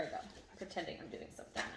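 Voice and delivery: soft, fast whisper. Real, not acted. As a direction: whisper it quickly. Whispering while ignoring the dog.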